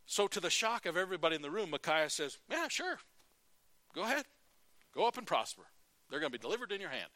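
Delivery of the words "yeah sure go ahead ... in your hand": high, mocking "sure, go ahead"; sarcastic. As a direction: drip sarcasm, start high. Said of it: "I can just hear the sarcasm in his voice".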